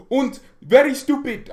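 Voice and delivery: loud, high put-on German accent; comic German accent sneer; mock German accent. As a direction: jeer in a hammed German accent. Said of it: "I'm gonna make accent jokes".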